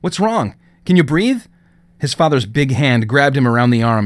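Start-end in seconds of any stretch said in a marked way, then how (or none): 0.0-1.4 worried. Acted. Son panics over collapsed father.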